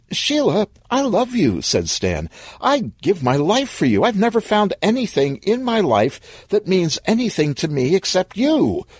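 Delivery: high-pitched earnest love declaration; lovesick, urgent appeal; desperate pleading. Acted. Boyfriend pleading his love.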